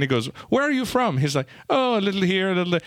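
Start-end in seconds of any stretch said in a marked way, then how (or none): 0.5-1.2 higher-pitched mock questioning; movie character quote. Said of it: "Pierce Brosnan's character comes on". 1.7-2.8 high, muddled mock-British accent; hammed-up Mrs. Doubtfire-style British accent; faked British accent. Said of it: "trying to fake the British accent".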